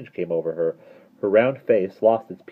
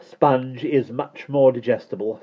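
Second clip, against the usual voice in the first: slow, higher prim voice; 6 semitones higher, 4 dB louder, slower than usual. A narrator's reading. Mary Poppins defending the sponge cake, prim. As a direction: state it primly, unhurried. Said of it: "said Mary Poppins primly".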